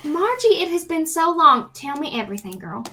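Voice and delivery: high, loud delighted hello; gushing greeting. Acted. Player greets Margie after so long, gushing.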